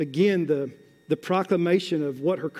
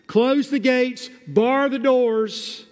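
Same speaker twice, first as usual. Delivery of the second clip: raised, high, slow alarm call; watchman's warning call; 6 semitones higher, 3 dB louder than usual. Acted. Watchman warning the city of intruders, urgent calling out. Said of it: "What does the watchman on the wall do with his voice? But he heeds and issues warnings".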